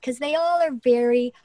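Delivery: normal talking voice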